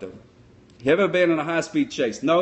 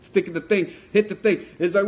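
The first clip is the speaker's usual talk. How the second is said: normal talking voice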